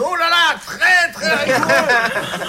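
high, slow and laughing, the Indian stretching out très. Acted. Indien voiced, laughing. (voix haute et traînante, en riant)

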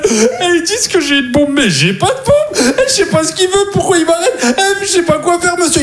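high, panicked, laughing plea; a hammed-up panic, voice way up; panicked pleading. Man in handcuffs pleading with his boss, mock panic.

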